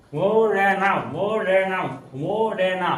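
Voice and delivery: मुरैना chanted slowly as "mooo-re-naa"; English pronunciation. Shown as a demonstration. Teacher shows it comes out automatically, मुरैना repeated as drawn-out "mo-re-naa". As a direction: stretch the first vowel of मुरैना to a long "mo". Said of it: "English pronunciation में बोलेंगे".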